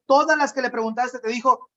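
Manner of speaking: usual speaking voice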